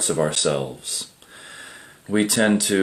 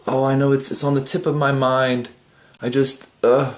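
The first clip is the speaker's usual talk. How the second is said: higher-pitched, mildly exasperated groping for a memory; straining to remember; 7 semitones higher than usual. Acted. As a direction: act out grasping at a lost dream. Acting out recalling a dream.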